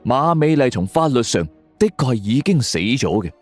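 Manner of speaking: plain reading voice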